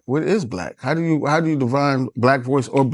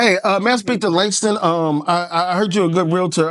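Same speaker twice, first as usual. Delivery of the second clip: higher, polite business-call voice; 4 semitones higher than usual. Shown as a demonstration. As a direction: put on your professional phone voice.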